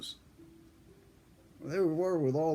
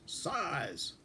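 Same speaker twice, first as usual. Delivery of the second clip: mock yell; 6 dB quieter than usual.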